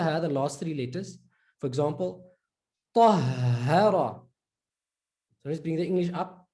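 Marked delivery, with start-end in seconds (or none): 2.9-4.2 tahara with a long breathed hh; doubled ha. Shown as a demonstration. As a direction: hold the h in tahara, slowly. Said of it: "You see there, double H".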